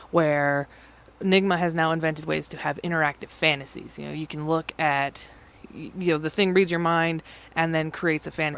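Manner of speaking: ordinary delivery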